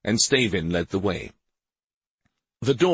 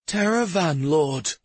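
trembling voice; 8 semitones higher than usual. Acted. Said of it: "answered in a trembling voice".

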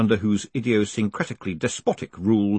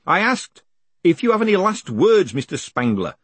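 higher, louder character voice; insistent hangman; 9 semitones higher, 5 dB louder than usual. Acted. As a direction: repeat it pointedly to the prisoner. Hangman repeats himself to condemned man.

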